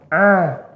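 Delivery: "A" with the sound let flow on a little. Shown as a demonstration. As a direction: sustain "A" so the voice flows. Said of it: "Alirkan sedikit suaranya". ("A" suaranya dialirkan)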